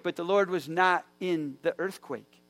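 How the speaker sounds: normal talking voice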